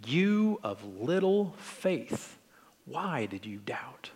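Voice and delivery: slow, hushed scolding with a dropping voice. Acted. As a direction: scold softly and slowly, drawing out each word. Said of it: "that same scolding tone".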